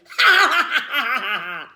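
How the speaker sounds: loud burst of laughter; hysterical laughter. A narrator's reading. Boy laughing at classmate's worm panic, hysterical laugh. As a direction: crack up uncontrollably. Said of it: "Max started laughing hysterically".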